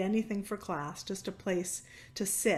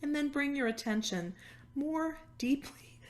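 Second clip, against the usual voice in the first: guided meditation; 3 dB quieter than usual. Acted. Yoga teacher settling a cancer-support class into stillness, guided meditation. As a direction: ease them into noticing, phrase by phrase, softening at the ends.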